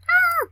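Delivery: sudden high loud yelp; startled scream. Real, not acted. Player screaming when something jumps out. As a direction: shriek at a jump scare.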